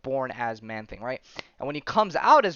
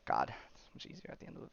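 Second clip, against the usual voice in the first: whispered grumble; 3 semitones lower, 17 dB quieter, faster than usual. Real, not acted. Muttering while searching image.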